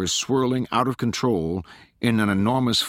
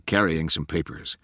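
plain reading voice

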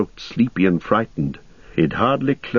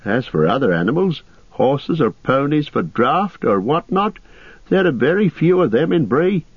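high, fretful innkeeper voice; unhappy landlord; 7 semitones higher than usual. Acted. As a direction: explain the bad news unhappily, pitched up. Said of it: "said the landlord unhappily".

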